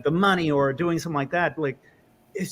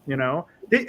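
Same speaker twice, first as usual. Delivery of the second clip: ordinary talk, nothing marked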